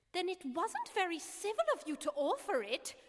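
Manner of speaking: angry. A narrator's reading. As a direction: scold him for the rude offer. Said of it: "said Alice angrily".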